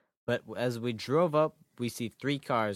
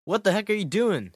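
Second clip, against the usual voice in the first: startled shout, higher and louder; frightened yell; 9 semitones higher, 6 dB louder than usual. Acted. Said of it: "I yelled in fright".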